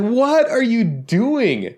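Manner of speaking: high, slow disbelieving; incredulous. Retold or imitated. Yelling at the show in slow high disbelief. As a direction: stretch it out, way up, in disbelief. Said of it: "I was like".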